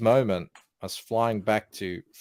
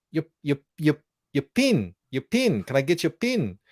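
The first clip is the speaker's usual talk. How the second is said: put-on higher, insistent New Zealand accent; thick New Zealand accent; 6 semitones higher than usual. New Zealand girl repeating herself to Mel, thick accent. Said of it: "had a really thick accent".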